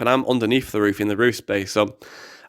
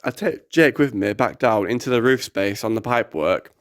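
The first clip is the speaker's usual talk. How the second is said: normal talking voice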